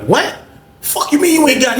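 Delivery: high incredulous snap; can't believe what he just heard; high, stunned and angry; outraged disbelief. Retold or imitated.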